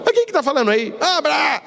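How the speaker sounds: high-pitched mock exasperation; exasperated phone call. Person on the phone fed up with guessing, exasperated. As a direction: act fed up, voice jumping up.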